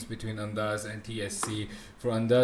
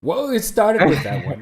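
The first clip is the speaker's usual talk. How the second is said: amused; 11 semitones higher, 7 dB louder than usual. Real, not acted. Amused reply about bad words.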